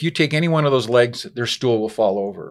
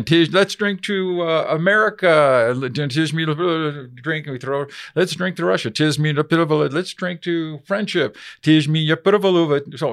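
mock Russian accent; 4 semitones higher, slower than usual. Russians toasting in story.

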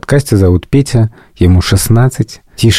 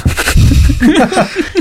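high delighted laugh. Real, not acted.

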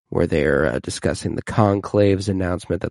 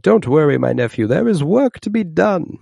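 higher, louder mock Tyrion voice; Tyrion impression; 9 semitones higher, 5 dB louder than usual. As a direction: play the smug uncle, pitched up. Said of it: "And he's like".

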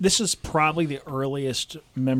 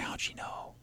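hushed wondering whisper; a child's hushed wonder; 13 dB quieter than usual. Retold or imitated. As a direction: breathe it like a secret.

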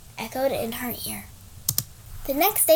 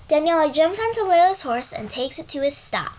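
ordinary delivery, nothing marked

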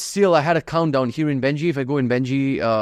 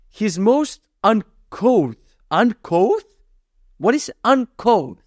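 slow, puzzled reading; baffled reading; 8 semitones higher, 3 dB louder, much slower than usual. Real, not acted. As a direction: sound out a strange word. Struggling with a hard word.

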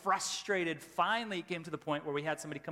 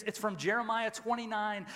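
ordinary delivery

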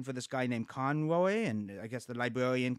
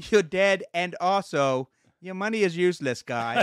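blunt mock telling-off; 3 semitones higher, 6 dB louder than usual. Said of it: "Just, just say".

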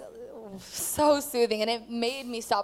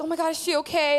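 higher-pitched worried question, imitated; alarmed concern; 7 semitones higher, faster than usual. Leaders react to the student's fall, alarmed. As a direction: act out startled onlookers checking on someone. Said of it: "a couple leaders are like".

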